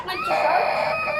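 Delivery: slow high shouted wail; high toy voice. Pretend. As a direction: wail like a charging monster. Daddy voices charging toy. (mataas na sigaw ng halimaw)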